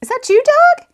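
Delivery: high, loud call to someone in the house; father calling out without getting up. A narrator's reading. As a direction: sing it out across the room. Father calls from other room.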